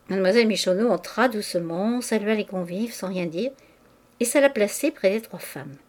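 ordinary delivery, nothing marked

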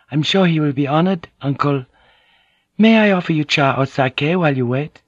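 gently voiced dutiful niece; polite young woman. Fujiko speaking to her uncle.

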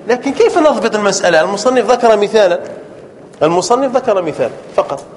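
ordinary talk, nothing marked

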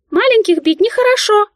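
high loud squeal while fleeing; shrieking squeal. A narrator's reading. Mouse fleeing heroine's slap: loud high squeal. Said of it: "верещала она".

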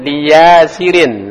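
Lia Sirin slowed right down, each syllable drawn out; slow teacher demo of Lia Sirin; reading with tanwin. Shown as a demonstration. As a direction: model Lia Sirin with the tanwin sounded clearly. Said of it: "Ya bener, tanwin".

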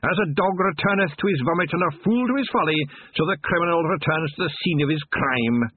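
high, gleeful Scottish Feegle; Scottish watchman voice. A narrator's reading. Wee Mad Arthur.

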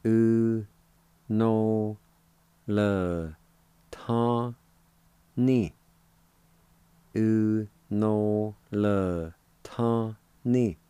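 separated syllables with clear pauses. Shown as a demonstration. Teacher showing learners the word January, syllable by syllable. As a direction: sound out each syllable on its own, held out. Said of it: "It's pronounced like this".

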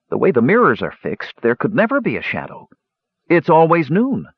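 puzzled objection, pitch climbing; confused. A narrator's reading. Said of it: "pointed out Palin in confusion".

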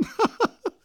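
high, scornful burst of laughter; bitter laugh. A narrator's reading. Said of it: "nach dem Lachen". (bitteres, spöttisches Lachen)

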